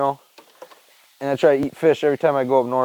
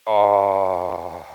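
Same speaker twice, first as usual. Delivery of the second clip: pained low groan; 7 semitones lower than usual. Retold or imitated. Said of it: "I'm just like".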